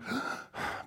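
demonstrated heavy breathing gasp; short, labored breath. Shown as a demonstration. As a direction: breathe in audibly, like an asthmatic. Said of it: "breathe heavy".

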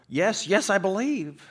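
high, loud, frantic agreement; scared. Acted. Dangling tourist desperate to convince the voice, scared. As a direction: answer desperately, voice pushed up high. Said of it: "'cause he's scared".

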